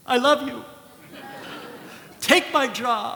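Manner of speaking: high, loud happy gush. Retold or imitated. Patient won over by the surgeon, gushing. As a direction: burst out in gratitude. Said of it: "I'm like".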